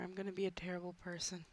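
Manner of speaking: fast, whispered mischief; whispered confession. Real, not acted. Gamer confessing his evil plan, whispered. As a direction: whisper it like a guilty secret.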